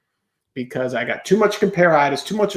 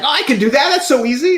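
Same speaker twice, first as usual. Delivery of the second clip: hammed-up cocky dismissal; overconfident; 7 semitones higher, faster than usual. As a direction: brush it off as easy.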